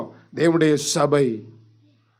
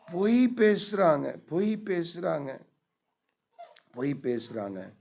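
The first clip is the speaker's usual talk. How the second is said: each repeat softer, dying to near silence; fading repetition; 9 dB quieter than usual. Acted. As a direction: let the words fade out. Repeated phrase fading away. (மெல்ல மங்கும் குரல்)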